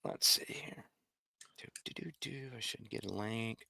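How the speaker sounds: soft muttered whisper; whispering to himself. Real, not acted. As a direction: whisper under your breath. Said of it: "whispers while multitasking".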